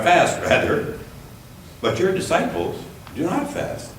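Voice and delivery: ordinary talk, nothing marked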